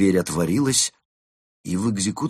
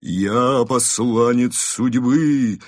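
very slow, chanting, higher and louder grave voice; grim reaper; 5 semitones higher, 6 dB louder, much slower than usual. Acted. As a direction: chant it like a ghostly herald of doom. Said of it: "пропел пришелец всё тем же замогильным голосом".

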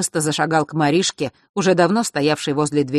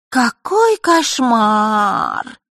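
mincing, higher, very slow and drawn out; affected Marishka imitation; 7 semitones higher, 5 dB louder, much slower than usual. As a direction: ham up a prissy girl's horror. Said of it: "жеманно произнесла ... копируя Маришку".

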